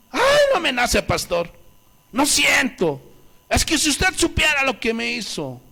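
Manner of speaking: mock the reluctant member pleading with the pastor; quejido de creyente. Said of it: "Y algunos todavía con". (imitando a un creyente quejumbroso, con voz aguda)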